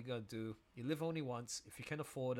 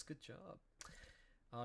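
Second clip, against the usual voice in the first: ordinary talk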